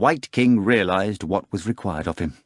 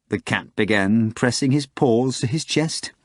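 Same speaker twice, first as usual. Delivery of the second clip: normal talking voice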